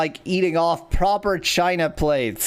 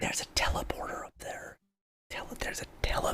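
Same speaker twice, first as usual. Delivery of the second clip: whispering; 14 dB quieter, faster than usual. Real, not acted. Whispered reveal during the game.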